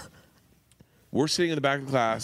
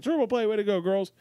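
put-on higher, cheering the girls on; coach cheering; 7 semitones higher than usual. Coach praising the girls after a triple play, cheering higher.

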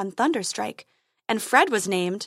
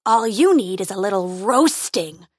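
roaring; 5 dB louder than usual. Acted. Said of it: "he roared".

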